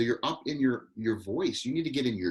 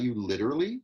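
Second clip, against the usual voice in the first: taken down. Shown as a demonstration. Director models the line for actor, brought down. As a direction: say "literally" unhurried, without shooting up. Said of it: "Slow it down".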